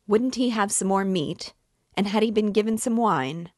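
put-on loud, pointed question to a deaf old man; asking aloud. A narrator's reading. Hostess calls across the table to the deaf guest, loudly. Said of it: "inquired aloud across the table of the deaf man".

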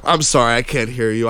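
ordinary talk, nothing marked

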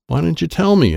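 high, mildly snappish question; snapped. A narrator's reading. Alligator scolds Troy for not telling, snappish. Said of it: "Alan snapped".

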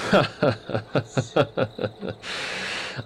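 soft breathy chuckle at his own joke; amused laugh. Acted. Knudsen chuckling after teasing Lindvig about renting rooms, quietly amused. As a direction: laugh quietly as you tease him.

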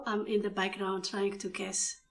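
ordinary talk, nothing marked

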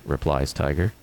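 normal talking voice

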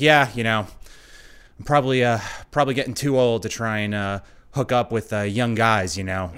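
mild imitation of a self-mocking driver; understated rueful driver, ending in a laugh. Voicing driver's confession.

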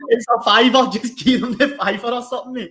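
high, loud, laughing through it; laughing mock haggle. Retelling telling his mate to pay, laughing. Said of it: "I was like".

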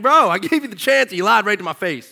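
God catching Cain lying, fed up; exasperated God. Retold or imitated. God scolding Cain in story.

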